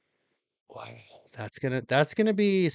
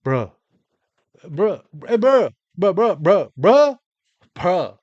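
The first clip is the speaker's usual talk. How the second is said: louder and higher bruh, bruh, bruh; mocking teen bro-speak; 3 semitones higher, 7 dB louder than usual. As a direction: imitate a kid repeating bruh. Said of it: "How you gonna communicate?".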